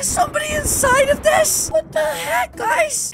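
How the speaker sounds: very high, startled voice; alarmed disbelief. Acted. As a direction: squeak it out in alarm. Discovers someone inside a coffin.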